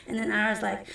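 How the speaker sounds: usual speaking voice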